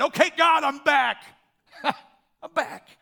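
loud, high shout breaking into a laugh; bold declaration. Acted. Preacher as returning Abram announcing himself to God, shouted. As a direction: holler it up to God. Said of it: "He's making a statement".

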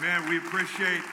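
usual speaking voice

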